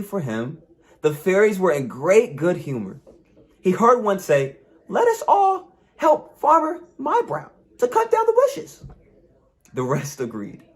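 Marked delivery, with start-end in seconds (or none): 4.9-8.8 high, laughing fairy voice; a gleeful little sprite offering help. Acted. Fairy tells the others to help the farmer, high and laughing.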